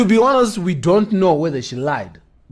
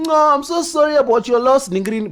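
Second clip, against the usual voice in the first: high-pitched put-on sympathy; mock condolences; 8 semitones higher than usual. Host mimics strangers posting condolences, mock sympathetic. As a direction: imitate gushing online sympathisers, pitched well up. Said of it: "I'm concerned about vanhu vandakaona vachiti".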